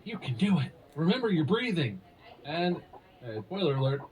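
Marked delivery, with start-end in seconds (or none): 0.0-2.0 slightly raised, higher coaching voice; mock encouragement. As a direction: cheer her on like a birth coach, lightly acted. Acted coaching during childbirth.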